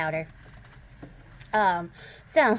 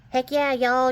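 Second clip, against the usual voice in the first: loud, higher imitation Southern twang; 6 semitones higher, 6 dB louder, slower than usual. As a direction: holler it like a hammed-up Texan. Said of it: "Southern than it".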